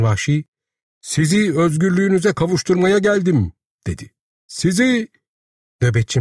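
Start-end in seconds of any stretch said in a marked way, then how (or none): 1.0-3.5 high, sustained declaiming; proclamation. A narrator's reading. Savage proclaims freedom to twins. 4.5-5.1 very high-pitched cry, left hanging; proclamation. A narrator's reading. Proclamation begins again, cut off.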